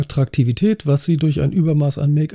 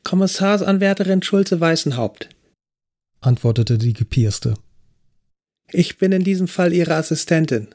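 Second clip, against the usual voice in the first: higher, perky voice of the pierced trainee; cheeky young officer; 4 semitones higher than usual. A narrator's reading. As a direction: play the cocky trainee introducing herself.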